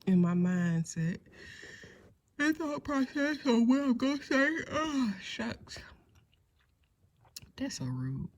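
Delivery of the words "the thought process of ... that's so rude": talking through a yawn; stretched out mid-yawn. Real, not acted. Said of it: "my yawns come as soon as I turn this video on".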